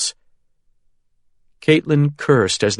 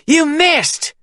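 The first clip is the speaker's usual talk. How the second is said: taunting yell; 3 dB louder than usual. A narrator's reading. Taunting the shooter.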